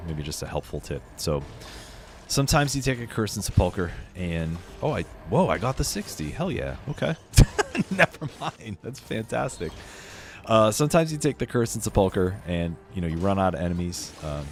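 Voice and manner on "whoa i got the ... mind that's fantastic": ordinary talk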